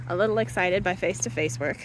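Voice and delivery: normal talking voice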